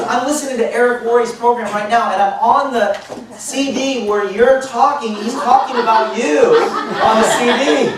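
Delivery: imitated high, loud amazement; excited. Woman on the phone about hearing him on CD, excited. Woman retells amazing coincidence.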